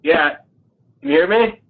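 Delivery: tinny voice over a speakerphone. Real, not acted. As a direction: sounds distant, like a phone on speaker. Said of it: "Sound like you're on a speakerphone".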